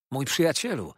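excited exclamation. A narrator's reading. Treadles addresses his old friend, excited cry. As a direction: call out to a beloved friend. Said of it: "wołał Treadles".

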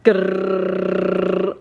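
long rolled playful "grrr"; mock growl. Wife growls playfully over the lip balm, mock growl. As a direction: growl it out on one held note.

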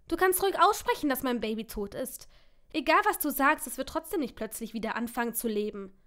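a loud bitter outburst with the voice jumping up; furious outburst. Acted. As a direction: lash out at him in grief. Angry outburst about dead baby.

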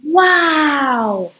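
delighted gasp of wonder; a big thrilled wow; excited wow. Acted. Pingu thrilled to go snowboarding, excited wow.

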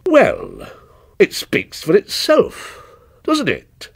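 mildly embarrassed, with pauses; said with effort. A narrator's reading. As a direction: force out a hesitant opinion. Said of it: "said with an effort".

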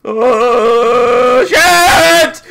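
high, drawn-out scream of alarm; panicked yell. Real, not acted. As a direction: yell out as it all goes wrong. Sudden in-game danger.

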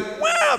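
very high mock squeal; child's scream. Child running around while father prays, high mock scream. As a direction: imitate a running child's yell. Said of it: "teriak-teriak".

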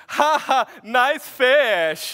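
put-on [name]'s laughing Borat-like accent, loud and high; mocking laugh in a Borat-like foreign accent. Adopted son from Uganda teases brother, Borat-like accent. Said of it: "sounded a little bit like Borat".

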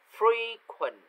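"frequent" with a high-pitched FRE, falling away; first-syllable stress. Shown as a demonstration. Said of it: "từ frequent sẽ nhấn âm nhất". (nhấn cao âm đầu của "frequent")

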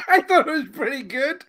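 high, giggling through words; laughing. Real, not acted. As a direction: laugh while you talk. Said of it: "laughing at his own joke".

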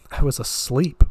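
usual speaking voice

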